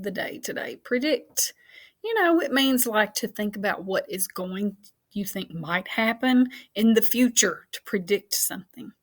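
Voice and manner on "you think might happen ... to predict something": ordinary delivery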